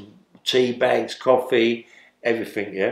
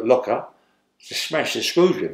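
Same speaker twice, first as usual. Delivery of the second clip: ordinary delivery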